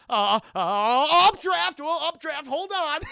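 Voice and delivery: high, loud mock alarm; flustered panic. Goliath dodging Elisa's awkward question, acted panic. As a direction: blurt an excuse in hammed-up panic.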